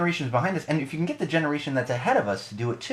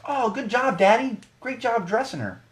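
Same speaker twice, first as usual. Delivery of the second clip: high, syrupy mock old lady; sweet cashier voice; 12 semitones higher than usual. Imitating the cashier cooing at the daughter, high and sugary. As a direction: coo at the toddler like a doting grandma. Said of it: "She goes".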